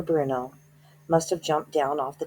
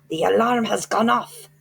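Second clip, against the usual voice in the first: higher, louder witch shriek; 6 semitones higher, 6 dB louder than usual. A narrator's reading. Grand High Witch shrieking to the audience that the alarm went off. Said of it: "shrieked the Grand High Witch".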